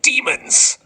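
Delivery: horror-movie screech; panicked scream. Acted. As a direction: scream it like you saw demons. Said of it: "at full volume".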